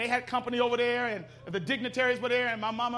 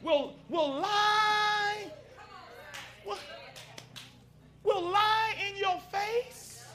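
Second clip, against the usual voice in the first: slow preaching build; 5 semitones higher, slower than usual. Acted. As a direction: stretch each word, climbing to the punch.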